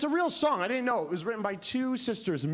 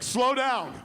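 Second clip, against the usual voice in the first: higher, louder overwhelmed plea; overwhelmed execs; 4 semitones higher, 5 dB louder than usual. Retold or imitated. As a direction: beg her to slow down, overwhelmed. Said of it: "they're like".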